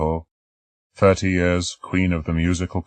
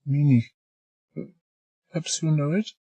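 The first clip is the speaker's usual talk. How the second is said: put-on shy girl; 11 semitones higher than usual. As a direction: answer shyly.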